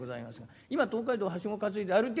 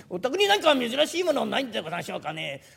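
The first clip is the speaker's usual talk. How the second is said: eager collector; 7 semitones higher, 8 dB louder than usual. Acted. Collector asking dealer.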